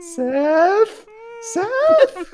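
high frightened call into the dark; scared calling out. Pretend. Scared calling for partner at night.

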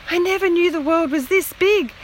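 a touch of breathless wonder; gasped. A narrator's reading. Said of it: "she gasped".

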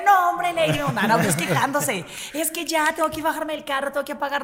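high-pitched, exasperated listener protest; mock complaining. Listeners complaining they must leave the car, mock exasperation. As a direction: imitate a fan whining about the delay. Imita oyentes quejándose por la espera.

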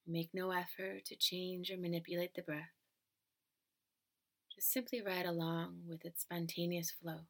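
calm, even relaxation-script delivery; guided meditation. Acted. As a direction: guide the listener gently into stillness.

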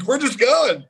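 high, loud panicky exclamation; startled disbelief. Retold or imitated. Drummer caught out by the song starting, startled disbelief.

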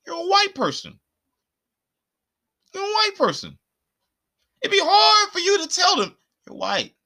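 high-pitched emphatic telling-off; exasperated insistence. Real, not acted. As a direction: hammer it home in a high, exasperated voice. Ranting at the absent singer.

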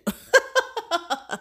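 bright burst of laughter; high laugh. Real, not acted. Laughs at own annoyance.

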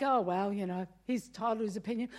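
mild mock indifference; shrugging it off. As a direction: brush it off lightly. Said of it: "The first time it's like".

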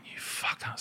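a whispered, seething insult; a whispered curse at the teenage player. Retold or imitated. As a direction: hiss it under your breath. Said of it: "And I went".

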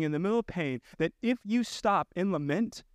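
ordinary talk

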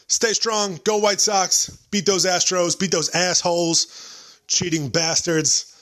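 rowdy fan cheer to close; hyped sign-off. Real, not acted. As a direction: pump up the listeners. Excited podcast sign-off.

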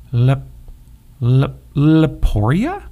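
slow, stumbling over the strange name; fumbling. A narrator's reading. Puppy Toby puzzling over a faraway land, fumbling slowly. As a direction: trip over the word, puzzled. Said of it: "Toby fumbled".

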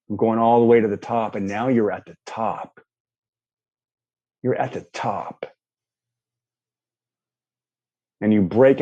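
calm guiding voice painting a scene; walk them through it like a daydream; guided visualization. Acted.

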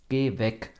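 a loud "go away" through the door; calling out. A narrator's reading. Vincent calls out to Timo at his door, loudly. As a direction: shout it through a closed door. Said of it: "schallte es von innen".

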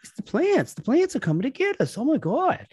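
a hammed-up Boston accent, yelping about the plants; terrible Boston accent. Mocking a confused Twilight Zone character in a bad Boston accent. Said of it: "Terrible Boston accent".

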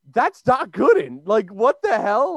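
replaying the shock of spotting him, drawn out; starstruck disbelief. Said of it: "but I'm like".

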